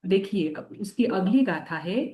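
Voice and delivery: ordinary talk, nothing marked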